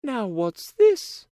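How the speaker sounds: put-on high, bright girlish princess voice; cartoon princess. A narrator's reading. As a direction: play a sweet young princess. Princess spots the frog.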